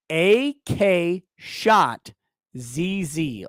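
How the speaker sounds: slow, spelled-out letters "A K Shot Z Z"; spelling it out slowly. Shown as a demonstration. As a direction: dictate each letter clearly so they can type it. Dictating the handle again.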